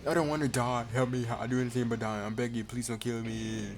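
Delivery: frantic plea to live; panicked begging. Acted. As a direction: beg as if facing death. Culprit begging not to die.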